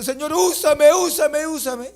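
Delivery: high, loud whiny begging, mocking the youths; mocking plea. Preacher mocking rebellious youths, high loud begging. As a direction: ham up a pleading teenager.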